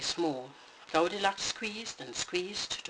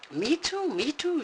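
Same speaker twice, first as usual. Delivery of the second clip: much higher, shrill squeak; Mama Bear shrilling in outrage; 10 semitones higher, 4 dB louder than usual. Acted. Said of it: "she shrilled".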